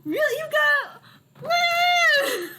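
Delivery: very high, loud disbelief; high-pitched stunned uncle; astonished uncle. Acted. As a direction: react amazed, voice shooting way up. Uncle learns of the boyfriend.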